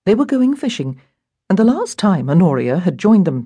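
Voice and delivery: ordinary delivery, nothing marked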